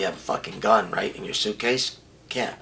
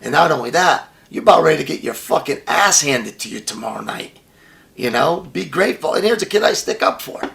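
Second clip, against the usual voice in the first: indignant rant; 6 semitones higher, 6 dB louder than usual. Real, not acted. Outraged at wrestler refusing.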